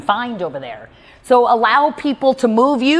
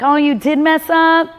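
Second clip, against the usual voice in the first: high, slow, drawn-out sympathy; mock pitying question; 7 semitones higher, 3 dB louder, slower than usual. Client fishing for agreement about her mistake, slow mock pity.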